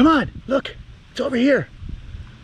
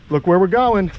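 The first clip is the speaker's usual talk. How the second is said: ordinary delivery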